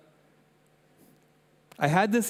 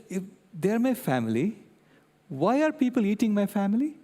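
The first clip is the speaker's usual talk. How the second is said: higher, lightly voiced indignant little girl; upset child; 6 semitones higher than usual. Granddaughter protesting to grandpa, in a lightly voiced upset child tone. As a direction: imitate a child's outrage. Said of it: "She said".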